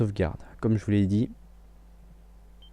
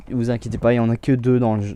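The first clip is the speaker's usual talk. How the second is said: normal talking voice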